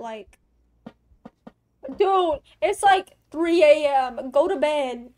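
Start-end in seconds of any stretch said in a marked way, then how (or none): 2.0-5.1 loud, slow scolding; exasperated scolding. Pretend. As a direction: tell him off loudly, dragging it out.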